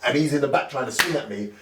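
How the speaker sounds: ordinary talk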